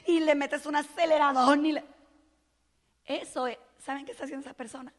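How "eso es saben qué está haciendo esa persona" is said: ordinary delivery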